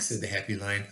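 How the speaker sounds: usual speaking voice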